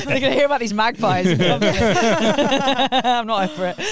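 said through a real laugh, slowly. Real, not acted. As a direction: laugh through the words, can't get over it. Laughing about the magpies.

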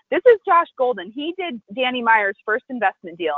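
high hostess voice, easing down; imitated gushing introduction. Hostess introducing an investor to her, gushing imitation.

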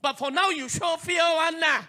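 high, loud sing-song taunt; a lightly voiced sly tempter; mock inner voice. Preacher imitating the sneaky inner voice, high and mocking. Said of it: "that voice, I was saying".

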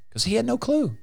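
gleeful jab. Real, not acted. Gleeful jab at the clueless devil.

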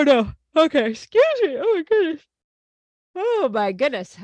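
high, strained words squeezed out through a yawn; real yawning. Real, not acted. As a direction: fight through a big yawn and apologise. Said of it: "now that we're talking about yawning".

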